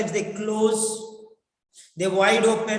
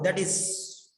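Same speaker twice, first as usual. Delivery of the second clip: normal talking voice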